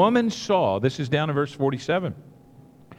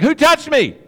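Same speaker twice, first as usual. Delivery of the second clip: much higher and louder, a playful demand; acted loud question; 10 semitones higher, 7 dB louder than usual. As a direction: call it out over the crowd. Jesus demanding in the crowd.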